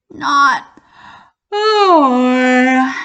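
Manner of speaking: long, loud, booming "Not I"; dog's drawn-out refusal. Acted. Dog to hen, long loud refusal. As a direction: stretch the refusal out big.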